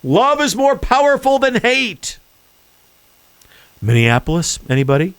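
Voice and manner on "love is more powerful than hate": mocking quote. Mocking Joe's speech line.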